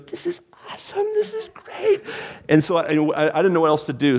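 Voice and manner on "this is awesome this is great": breathless high whisper of awe; high hushed awestruck gasp; awed teen. Retold or imitated. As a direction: whisper it, wide-eyed and thrilled. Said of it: "He goes".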